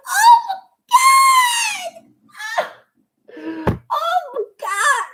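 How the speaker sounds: high, slow screaming; ecstatic screaming. Real, not acted. Fan screaming at the news of a dream feud. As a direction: lose it completely. Screaming over Punk vs MJF.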